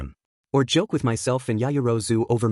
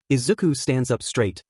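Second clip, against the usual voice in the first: usual speaking voice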